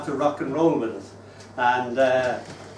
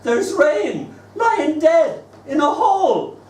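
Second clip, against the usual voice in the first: put-on much higher, louder slow little girl's exclaim; small daughter; 13 semitones higher, 6 dB louder, slower than usual. Toddler seeing her first puddle, high and loud. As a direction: marvel at it like a toddler. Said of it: "Her first puddle".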